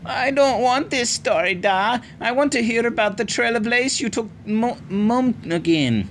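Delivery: put-on high, pleading child's voice; insistent little girl. A narrator's reading. Little sister demanding her favourite story from Da. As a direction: insist like a stubborn young girl. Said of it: "Ailish insisted".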